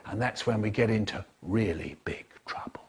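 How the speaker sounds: whispered, hushed and ominous. Acted. Narrator whispering the ominous turn. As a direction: breathe it out like a secret.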